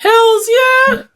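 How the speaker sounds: excited yell. Real, not acted. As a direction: blurt it out like a thrilled fan. Eager answer about loved series.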